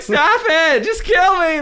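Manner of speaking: high, loud hammed-up plea; mock desperate yell. Guest retelling her plea to her partner in the game, mock yell. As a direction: wail it like you're begging for mercy. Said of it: "I'm just like".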